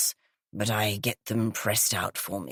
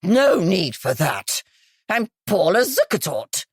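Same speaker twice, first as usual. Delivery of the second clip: cried out; 4 dB louder than usual. A narrator's reading. Guest crying out as the sergeant searches her bag. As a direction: call out to stop the search. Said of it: "she cried out".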